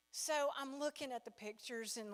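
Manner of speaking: normal talking voice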